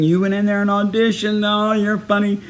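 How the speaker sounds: high, held-up teasing voice; mock laughing tease. Friends ribbing him back in the car, mock laughing tease. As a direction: imitate friends laughing along as they tease. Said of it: "everybody was laughing".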